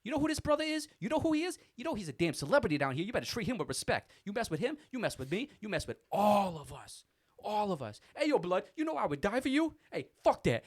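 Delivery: very high, loud, fierce loyal shout; Corey yelling at the old lady in line; screaming devotion. Retold or imitated. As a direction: swear loyalty at the top of his lungs. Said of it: "screaming at me, telling how much he loves me".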